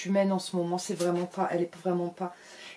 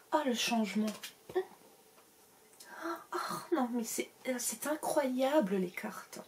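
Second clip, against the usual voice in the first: mock amazement; 5 semitones higher, 6 dB quieter than usual. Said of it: "c'est de nouveau Rose qui parle".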